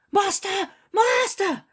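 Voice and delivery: high, loud, breathless calling out; panting shout. A narrator's reading. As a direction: Peter runs up shouting for the master. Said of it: "he shouts, panting".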